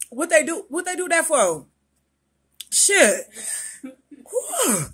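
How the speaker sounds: shocked by the burn; mouth burning from spicy food. Real, not acted. First spicy wing bite.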